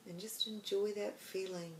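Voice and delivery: soft, hushed meditation voice with long pauses; guided meditation. Acted.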